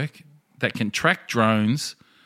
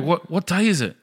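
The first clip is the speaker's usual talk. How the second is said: dazed waking official; 8 semitones higher than usual. Retold or imitated.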